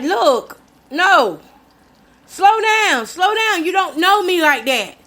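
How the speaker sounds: high, pressing warning; firm warning. Retold or imitated. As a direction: put him in his place. Telling the man to back off.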